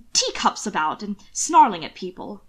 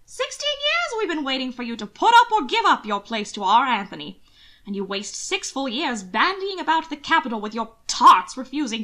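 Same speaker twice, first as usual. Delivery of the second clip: higher, louder scolding aunt; complaining old aunt; 5 semitones higher, 4 dB louder than usual. Acted. Aunt Grace scolds Hannibal, high and loud. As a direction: nag him for his lost years.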